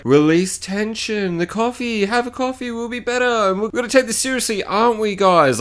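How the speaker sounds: hammed-up motherly high voice; mock soothing mum. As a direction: coo at them like boys to settle down. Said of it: "like she's a fucking mum".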